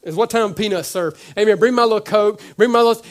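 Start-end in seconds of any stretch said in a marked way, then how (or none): 1.4-3.0 louder, higher mock demand for a snack. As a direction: play the spoiled passenger demanding a Coke. Mocking entitled churchgoer.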